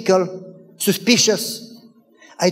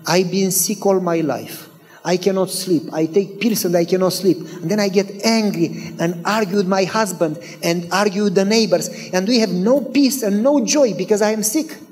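ordinary talk

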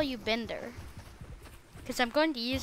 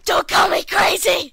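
furious yell; angry shout; 5 semitones higher, 10 dB louder than usual. Acted. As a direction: scream it back in rage. Villain snaps at visitor.

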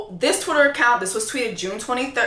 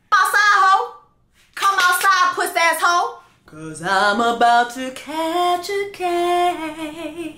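mock call-out; 6 semitones higher, slower than usual. Imagined calling Jackie outside.

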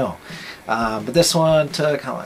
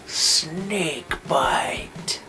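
whisper; 3 semitones higher than usual. Pretend. Whispered truck name after announcer voice.

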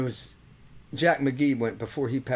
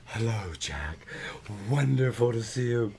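low, hushed croon; imitated dog-talking voice; 3 semitones lower, 3 dB quieter than usual. Kids greeting Uncle Norm's dog, imitated low croon. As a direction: mimic kids putting on a soft low voice. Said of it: "when they would talk to the dog".

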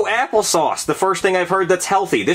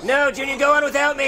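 high, strained heroic plea; dramatic sacrifice; 9 semitones higher than usual. Acted. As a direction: cry it out like a doomed comrade. Mock-heroic farewell to friend.

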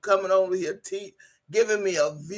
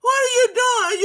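far higher and louder, breaking into laughter; laughing shriek; 13 semitones higher, 4 dB louder than usual. Real, not acted. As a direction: shriek it through helpless laughter. Repeats, laughing harder.

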